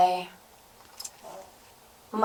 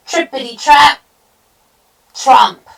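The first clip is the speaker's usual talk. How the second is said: loud rhythmic stomping beats; thundering storybook hooves; hoofbeat sound words; 7 semitones higher, 13 dB louder than usual. A narrator's reading. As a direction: hit each hoofstep hard. Big goat's heavy hoof sounds.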